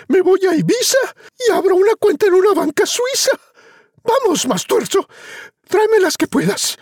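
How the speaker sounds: very high, rushed greedy squeal; giddy mother. Acted. Mother dreams of riches, sending Juan up. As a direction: gush with greed and glee. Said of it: "pegó otro brinco y dijo".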